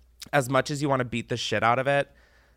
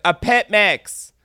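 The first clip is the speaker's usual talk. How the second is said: louder and slower for a deaf ear; 8 semitones higher, 6 dB louder, much slower than usual. Retold or imitated. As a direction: spell it out loud, slowly. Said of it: "And I was like".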